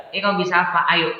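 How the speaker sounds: cocky comeback, lightly acted; playful boastful challenge. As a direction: throw down a retold challenge. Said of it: "saya udah nyombongkan diri".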